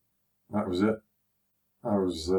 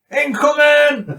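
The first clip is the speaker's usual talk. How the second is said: very high, loud, slow battle cry; drunken soldier's mock alarm call; drawn-out yell; 10 dB louder, much slower than usual. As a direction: bellow the warning slowly. Throwing thunder flashes drunk.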